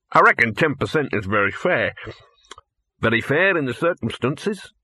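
talking through a mouthful of meat; chewing while talking; mouth full. A narrator's reading. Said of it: "Gaspode's voice was muffled by meat".

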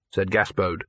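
plain reading voice

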